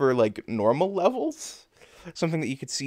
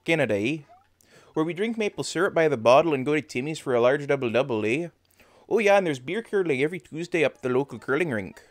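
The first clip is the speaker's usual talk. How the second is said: put-on thick Canadian hoser accent. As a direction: lay on the folksy Canadian accent, with "eh". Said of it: "have an accent like this".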